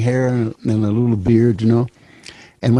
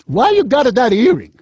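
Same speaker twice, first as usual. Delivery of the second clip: put-on high, louder old Italian grandmother; grandma voice; 12 semitones higher, 4 dB louder than usual. Dying grandmother scolding her grandson about his earring, high voice. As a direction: tease him like a grandma. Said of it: "she said".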